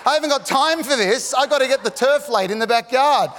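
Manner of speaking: high-pitched comic protest; mock exasperation. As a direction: complain as if far too busy. Comic inner protest at delay.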